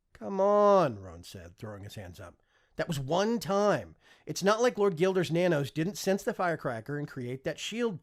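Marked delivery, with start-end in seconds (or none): 0.1-1.0 louder, higher protest; exasperated protest. Acted. Son argues with father. 2.8-8.0 heated defence. Acted. Son argues with father.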